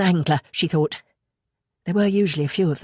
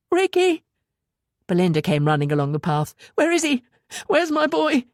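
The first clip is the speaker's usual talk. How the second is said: frantic calling; 10 semitones higher than usual. A narrator's reading. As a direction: call out in a panic. Mother runs calling for son.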